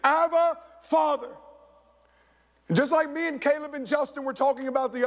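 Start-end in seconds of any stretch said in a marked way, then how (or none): 0.0-1.3 crying out. Pretend. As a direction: call it out like a child to a father. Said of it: "can cry out in the same way".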